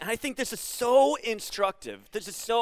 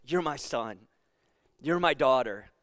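with affection; 7 semitones lower than usual. Shown as a demonstration. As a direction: say it like a loving parent to a child. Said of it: "a parent saying that with affection in their voice".

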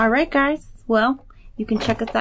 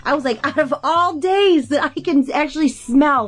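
laughing; 7 semitones higher than usual. Real, not acted. Laughing about smelling patients.

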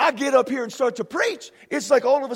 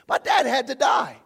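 ordinary talk, nothing marked

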